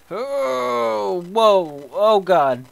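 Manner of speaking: sudden high gasp of fright; jolted by a scare, cry out; startled yelp. Real, not acted. Player jumps at a scare, startled yelp. Jump scare in horror game.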